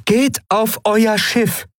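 high, slow, otherworldly order; commanding alien voice. Acted. The Shayang orders the crew to their ship, high and slow. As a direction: send them off the ship, high and measured. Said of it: "befahl Aylan".

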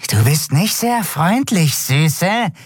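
high, menacing hiss. Acted. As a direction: hiss the insult. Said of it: "zischte es". (hoch und zischend)

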